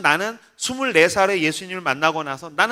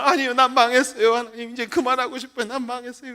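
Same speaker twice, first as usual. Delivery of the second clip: mock wailing despair; 4 semitones higher than usual. Pastor wanting to quit, mock tearful wailing. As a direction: wail as if crying, letting it sink away. Acted relapse into wailing again.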